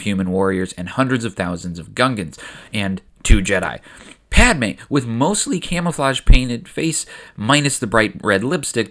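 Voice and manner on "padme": ordinary talk, nothing marked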